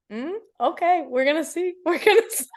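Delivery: God answering the no-complaining vow, amused doubt; knowing God. Retold or imitated. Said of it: "God goes".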